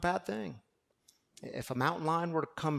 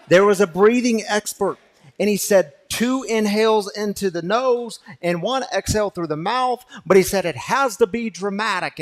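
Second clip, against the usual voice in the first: louder preaching, pressing the congregation; full-force preaching; 4 semitones higher, 14 dB louder than usual. Acted. Pastor urging the congregation to try dramatic breathing, loud and forceful. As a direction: preach it out with energy.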